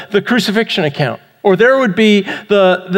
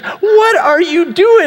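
high, slow mock astonishment, stretched out; baffled wife; 9 semitones higher, slower than usual. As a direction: imitate an incredulous wife at the door. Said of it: "she goes".

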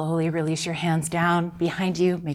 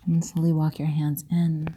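soft spa whisper; 6 dB quieter than usual. Real, not acted. Yoga cue whispered to class.

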